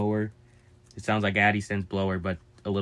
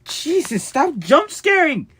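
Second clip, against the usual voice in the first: shrieked in sudden fright; startled yell; 9 dB louder, much slower than usual. Real, not acted. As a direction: jumps and yells at the scare. Startled by the machine.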